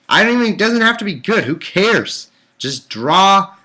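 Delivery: loud, high, fed-up yelling at the book; exasperated shout. Real, not acted. Reader yelling at the Bible author, exasperated shout. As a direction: vent your frustration at the writer.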